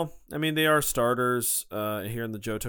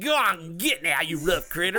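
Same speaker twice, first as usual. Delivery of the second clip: put-on hillbilly accent; 4 semitones higher than usual. Hillbilly voice play.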